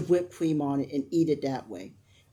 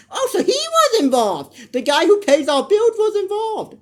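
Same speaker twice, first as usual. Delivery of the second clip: high, loud mock realization; hammed-up shocked gasp of understanding; 14 semitones higher, 7 dB louder, slower than usual. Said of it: "the way he looks at Hope".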